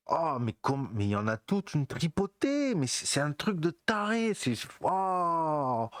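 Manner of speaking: high-pitched, hammed-up wonder at a lucky find; amazed discovery. Forager stumbling on a whole patch of blueberries, hammed-up amazement. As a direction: gasp over the haul, pitched well up. Acts out finding a myrtle patch.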